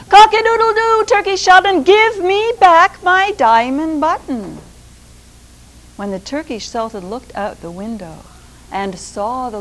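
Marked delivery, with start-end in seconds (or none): 0.1-4.6 high, loud rooster crow and demand. Acted. Rooster calling up at the Sultan's window, high and loud crowing. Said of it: "he cried out".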